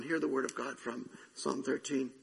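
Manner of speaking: ordinary delivery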